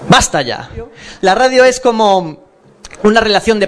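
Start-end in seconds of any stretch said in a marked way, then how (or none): 0.1-0.7 much lower, deeper repeat. Acted. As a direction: drop the pitch way down.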